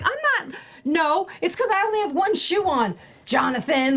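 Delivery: high squeaky mock protest, laughing. Actor teasing her co-star on set, high mock protest. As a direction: squeal out the denial in fun. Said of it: "I'm like".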